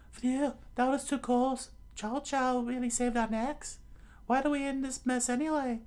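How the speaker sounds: put-on very high falsetto girl's voice; Nami, relieved then grumbling. Plays Nami in the story.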